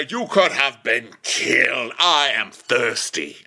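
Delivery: slow, drawn-out declaiming; hammed-up royal lament; melodramatic king. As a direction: stretch each word for mock drama. King's rant turns to thirst.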